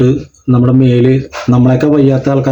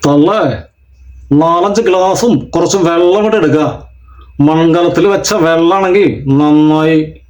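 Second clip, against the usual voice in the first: slow, drawn-out film villain; Mohanraj; 2 semitones higher, slower than usual. Shown as a demonstration. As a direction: stretch the words like a screen heavy. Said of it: "കിരീടക്കാരനായി വന്ന മോഹൻരാജ്".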